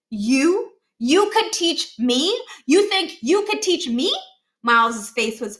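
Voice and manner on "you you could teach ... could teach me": higher, slow disbelieving rise; can't believe what he's hearing; an offended boy scoffing in disbelief; incredulous. A narrator's reading. Miles incredulous at Niles.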